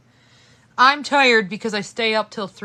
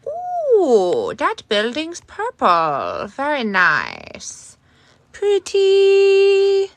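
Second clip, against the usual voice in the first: slow, higher cooing admiration; 9 semitones higher, 9 dB louder, much slower than usual. Acted. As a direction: swoon over the lights. Cooing over lights.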